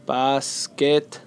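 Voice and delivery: basketball slowly split as bas, ket, ball; syllable by syllable. Shown as a demonstration. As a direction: break basketball into three slow beats. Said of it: "tres sílabas".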